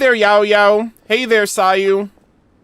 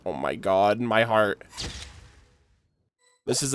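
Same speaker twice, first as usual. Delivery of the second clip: softer, lower, melting murmur; overwhelmed hush; 11 semitones lower, 10 dB quieter than usual. Real, not acted. Streamer melting over the kids, hushed. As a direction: let it fall away, heart melted.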